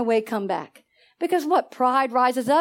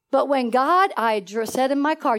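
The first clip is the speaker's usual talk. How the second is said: ordinary delivery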